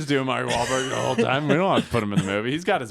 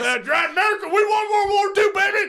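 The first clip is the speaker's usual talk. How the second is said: much higher, loud whooping boast; mock patriotic cheer; 4 dB louder than usual. Cheering America's war win to a friend, loud mock whoop. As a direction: crow it like a rowdy fan.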